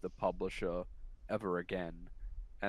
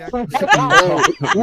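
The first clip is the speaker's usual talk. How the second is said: laughing; 22 dB louder, much slower than usual. Real, not acted. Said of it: "pull the brakes".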